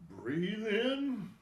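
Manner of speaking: calm, spaced-out breathing instructions with long pauses; guided breathing cues. Acted. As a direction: guide the class through each breath, unhurried. Leads breathing exercise.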